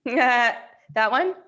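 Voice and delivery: a laugh put on when asked; a requested laugh, a little hammed-up. Shown as a demonstration. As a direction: do the laugh again for them. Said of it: "Do that laugh again".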